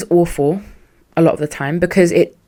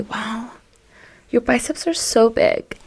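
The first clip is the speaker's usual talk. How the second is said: soft, high, put-on admiring voice; coy flattery; 4 semitones higher, 4 dB quieter, slower than usual. Woman flattering a man to carry her bag, coy admiration. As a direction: coo it with hammed-up awe.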